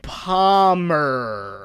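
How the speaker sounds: "Palmer" stretched out with a very hard R; mock coached "Palmer", R held long; overdone American R. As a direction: really hit the R at the end of "Palmer". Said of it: "you gotta really hit the R there".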